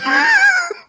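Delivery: sudden high shriek of laughter. Real, not acted. Recapping Wanda's threat to Reed, high shrieking laugh. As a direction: burst out laughing.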